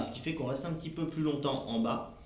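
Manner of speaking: normal talking voice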